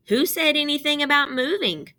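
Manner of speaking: put-on surprised kid brother asking. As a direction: lift the pitch for a surprised little brother. Brother Aussie asks in story.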